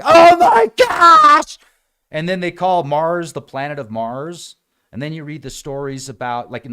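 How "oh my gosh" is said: very high, loud, drawn-out gasp of a shout; amazed shout. Retold or imitated. Onlookers watching Mars get destroyed, amazed shout. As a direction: stretch it out in total awe. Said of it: "they're like".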